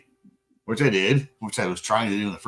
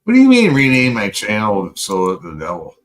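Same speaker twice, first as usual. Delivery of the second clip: mock outrage; 4 semitones lower, 8 dB louder than usual. Mock outrage at channel name.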